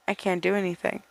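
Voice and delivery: hushed despairing whisper. Acted. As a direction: breathe it out in a whisper.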